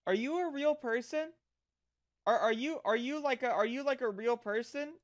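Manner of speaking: normal talking voice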